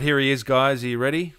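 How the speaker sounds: ordinary talk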